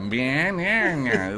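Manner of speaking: banana drawn out very slowly, American vowels pulled long and high; exaggerated slow American banana, vowels elongated; stretched American accent. Shown as a demonstration. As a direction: stretch every syllable of banana like a mock American. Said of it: "it's very stretched".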